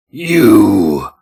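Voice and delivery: loud growling monster cry; throaty growl. Acted. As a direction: growl it like a beast. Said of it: "sounded like a throaty growl".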